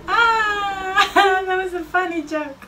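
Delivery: high, near-crying laughter; shaken laughter. Real, not acted. Player after a jump scare, laughing high and shaken. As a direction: laugh helplessly through the words.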